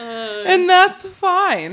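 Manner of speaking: slow, high, tipsy drawl. Real, not acted. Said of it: "may or may not be slightly tipsy".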